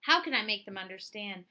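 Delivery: ordinary delivery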